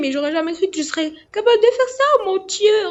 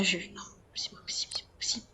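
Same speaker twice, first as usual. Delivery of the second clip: breathless whisper of disbelief; mother whispering in shock after the prank; stunned whisper; 9 semitones lower, 15 dB quieter, faster than usual. Real, not acted. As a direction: barely voice it, about to faint.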